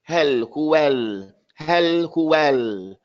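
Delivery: "Hal huwal" very slow, each l fully sounded; clear articulation. Shown as a demonstration. Teacher wanting both lams clear, slow careful articulation. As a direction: draw out "Hal huwal" with crisp final lams. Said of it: "we want it clear".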